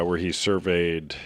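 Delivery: normal talking voice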